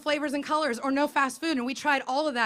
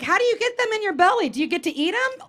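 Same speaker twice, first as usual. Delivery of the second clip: put-on curious child; 6 semitones higher, 4 dB louder than usual. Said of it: "she's like".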